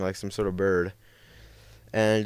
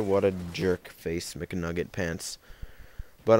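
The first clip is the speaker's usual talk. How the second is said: usual speaking voice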